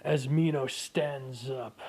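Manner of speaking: ordinary talk, nothing marked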